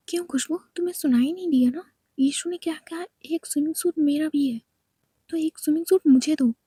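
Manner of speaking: loud, indignant demand to a friend; demanding girl. Acted. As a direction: snap at Khushbu and insist she hand it over. Natasha demands the swimsuit.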